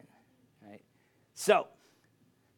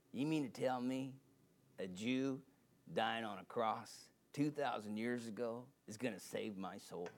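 lightly voiced father; 3 semitones lower, 9 dB quieter than usual. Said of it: "my dad talked like this".

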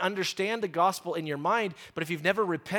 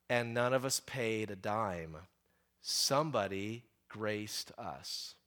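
slow, low and soft; hushed, solemn; 7 semitones lower, 8 dB quieter, slower than usual. Real, not acted. Preacher closing a story of grace, hushed and solemn. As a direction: let it drop low and land slowly.

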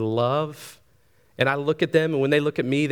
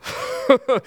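short laugh; 8 semitones higher, 3 dB louder than usual. Real, not acted. Laughs at his own panic bit.

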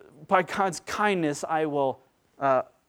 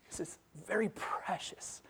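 near-whisper, savoring each word; hushed reverence; 3 semitones higher, 13 dB quieter than usual. Real, not acted. Preacher dwelling on Peter's very precious phrase, hushed. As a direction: drop to an awed whisper.

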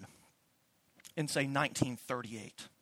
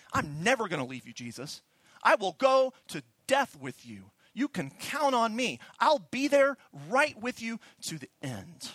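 earnest vow; 6 semitones higher, 6 dB louder than usual. Retold or imitated. Peter vows loyalty to Jesus.

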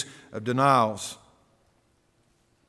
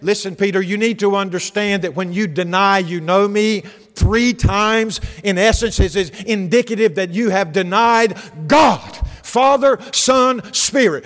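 higher, louder emphatic preaching; Jesus warning Peter; 5 semitones higher, 5 dB louder than usual. Acted. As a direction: hammer three times home with rising force.

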